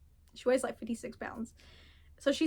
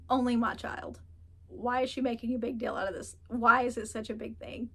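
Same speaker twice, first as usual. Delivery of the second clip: ordinary talk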